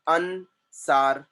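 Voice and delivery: "अनसार" slowly split as अन, सार; "अनसार" in two measured beats; two separate syllables. Shown as a demonstration. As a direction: pause between अन and सार in "अनसार". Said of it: "there are two syllables in this word".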